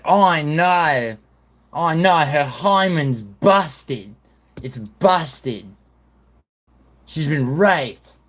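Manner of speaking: high, slow, loud mock Australian; Australian accent. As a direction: play a hammed-up, appalled Australian witness. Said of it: "if an Australian".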